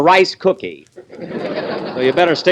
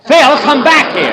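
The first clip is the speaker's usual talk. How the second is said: loud, high call after him; 12 semitones higher, 6 dB louder than usual. Real, not acted. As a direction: shout after someone leaving. Calling after Phil.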